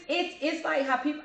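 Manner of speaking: usual speaking voice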